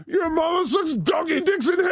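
high-pitched mock demonic shout; sudden shouted insult, hammed up; outburst of profanity. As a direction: blurt the curse out high and fast. Profane outburst he claims he can't say.

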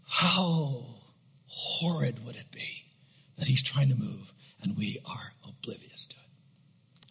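slow, low, very soft; hushed dread. Real, not acted. Preacher dreading being oblivious to God, slow hushed gravity. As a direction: weigh each phrase, sinking toward a whisper.

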